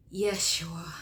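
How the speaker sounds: sighed out in a whisper. Retold or imitated. Head on hands at the sink, sighing one word. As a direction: let the name out on a weary, whispered sigh. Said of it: "I sighed and just said one word".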